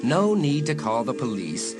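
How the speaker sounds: ordinary talk, nothing marked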